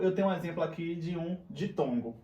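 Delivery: ordinary talk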